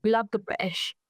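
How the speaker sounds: put-on British accent; playful imitation of a British speaker; mock British accent. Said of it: "Spot on British accent".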